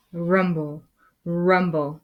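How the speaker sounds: ordinary delivery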